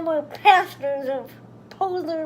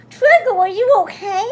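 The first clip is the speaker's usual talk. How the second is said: gothic voice; 4 semitones higher, 8 dB louder than usual. Acted. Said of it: "I asked in a gothic voice".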